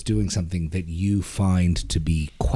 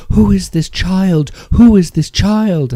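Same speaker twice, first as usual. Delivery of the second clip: high, loud alarmed yell; retold scream; 13 semitones higher, 7 dB louder than usual. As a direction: shout in panic at a lost child. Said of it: "and she's screaming".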